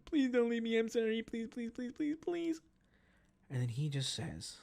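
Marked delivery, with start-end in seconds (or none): 0.1-2.6 high, squeaky mock begging; hammed-up desperate wail; pleading woman. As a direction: rattle off a whiny falsetto plea. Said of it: "she's going".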